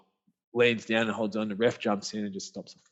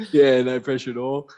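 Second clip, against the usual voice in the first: laughing; 3 semitones higher, 8 dB louder than usual. Real, not acted. Laughing at family pressure.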